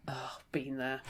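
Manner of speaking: rueful aside whisper. Real, not acted. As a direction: whisper it knowingly.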